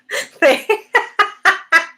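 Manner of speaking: high, bubbling run of laughs; amused laughter. Real, not acted. Host reacting to a viewer's comment, amused laughter. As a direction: burst into high giggles.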